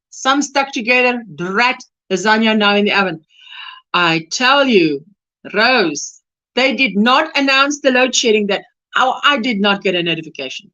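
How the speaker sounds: ordinary talk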